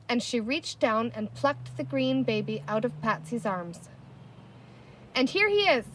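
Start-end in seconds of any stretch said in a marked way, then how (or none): 5.1-5.9 high, bright triumphant reveal; cried out. A narrator's reading. Girl shows baby to crowd, high triumphant cry. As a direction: present him triumphantly. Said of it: "she cried".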